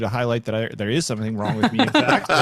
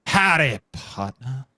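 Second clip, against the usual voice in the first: cowboy greeting; much slower than usual. Acted. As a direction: greet like an old western cowboy. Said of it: "See, Matt?".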